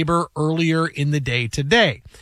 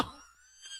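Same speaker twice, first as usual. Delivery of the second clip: ordinary talk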